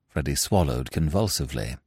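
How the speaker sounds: usual speaking voice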